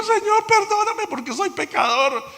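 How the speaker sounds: high, loud begging for forgiveness; pleading Peter. Retold or imitated. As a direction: cry out to the Lord in shame. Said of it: "le dijo".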